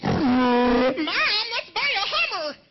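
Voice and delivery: loud, high, drawn-out mock wail; hammed-up crying kid; distraught kid. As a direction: scream it like a horrified child to Mom. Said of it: "a mighty different reaction from the kids".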